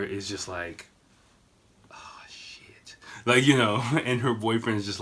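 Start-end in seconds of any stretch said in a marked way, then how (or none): 1.9-3.0 whispered "oh no" dread; mortified whisper. Retold or imitated. Daughter watching her drunk family, mortified whisper. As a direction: whisper it, imitating someone mortified.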